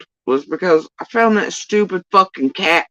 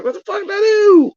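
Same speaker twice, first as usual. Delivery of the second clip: much higher, squeaky mock outrage; mock exasperated squeal. To viewers, imitating her usual reaction in a high squeal. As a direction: play up her own baffled outrage, pitched high. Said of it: "I'm always like".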